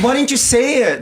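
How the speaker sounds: exasperated retort. Retold or imitated. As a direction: complain to a friend in mock exasperation. Retells complaining to his friend.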